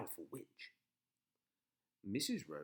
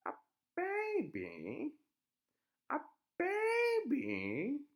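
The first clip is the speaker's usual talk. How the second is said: high, slow witch musing; drawn-out witch voice; 7 dB louder, much slower than usual. A narrator's reading.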